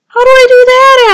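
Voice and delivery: high, fast, loud question; panicky call. A narrator's reading. Tudley asks turtles below, panicky call. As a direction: shout it down in a fluster.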